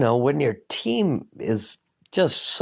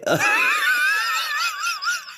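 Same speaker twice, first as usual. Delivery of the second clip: howling laugh. Real, not acted. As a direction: howl with laughter. Laughs loudly at story.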